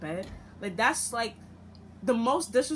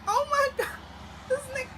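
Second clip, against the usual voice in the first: high, tearful shock; hurt girlfriend breaking into a cry; faster than usual. Retold or imitated. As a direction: cry out in disbelief. Finding him with another girl.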